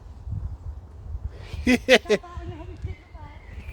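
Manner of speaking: airy high-pitched giggle; a giggle that is mostly breath; high breathy giggle. Real, not acted. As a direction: let out a high airless giggle. Giggling about pixies and fairies.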